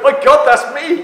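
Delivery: high, loud and slow; shocked. Retold or imitated. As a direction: gasp at seeing your own name. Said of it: "It's like".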